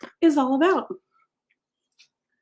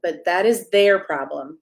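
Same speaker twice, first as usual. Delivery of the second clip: usual speaking voice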